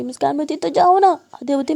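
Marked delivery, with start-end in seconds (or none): 0.6-1.1 defiant. A narrator's reading. Girl defiantly tells her go.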